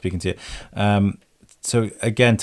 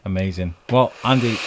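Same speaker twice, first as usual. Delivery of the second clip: usual speaking voice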